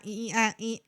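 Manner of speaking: ordinary talk, nothing marked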